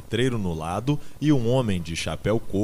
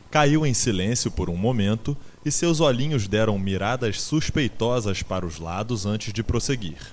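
ordinary delivery

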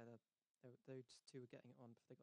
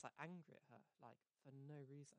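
ordinary talk

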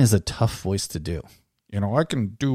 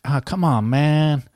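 Biden catchphrase; 6 semitones higher, 5 dB louder, slower than usual. Shown as a demonstration. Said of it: "you gotta use".

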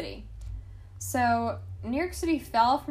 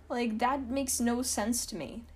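normal talking voice

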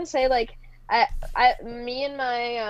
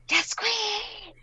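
high squealed cheer, not whispered; shrill fangirl squeal, fully voiced; 9 semitones higher, 4 dB quieter than usual. Shown as a demonstration. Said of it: "Like this?".

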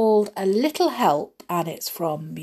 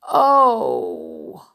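long, raised, falling "oh"; delighted wonder; 4 semitones higher, 6 dB louder than usual. A narrator's reading. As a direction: draw it out in awe. Belle and Beast hear harp music.

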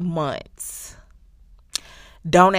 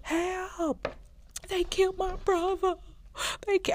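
high, frantic fake cry for help; mock screaming; 12 semitones higher, 6 dB quieter, slower than usual. Recapping a show, imitating the character's staged screams. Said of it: "he started screaming".

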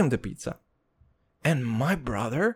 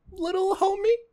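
high, teasing "little homie"; playful high call to little brother; mock cutesy; 4 dB quieter than usual. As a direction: coo it like to a kid. Mock-cute address to brother.

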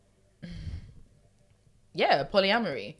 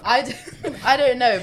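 laughing; 6 semitones higher, 9 dB louder than usual. Real, not acted. Laughing after joke.

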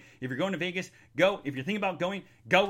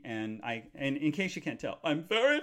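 normal talking voice

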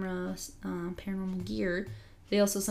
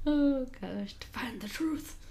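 higher, whispered and hushed; mock dramatic whisper; 6 semitones higher, slower than usual. Answering why she stayed, mock mysterious whisper. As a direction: hush it like a grand secret.

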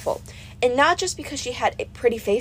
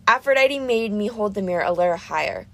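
ordinary delivery, nothing marked

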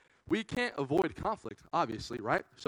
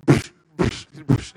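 breathy psh psh airsoft shots; gun noises; 2 semitones lower, 12 dB louder, slower than usual. Retold or imitated. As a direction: imitate quick airsoft gun pops.

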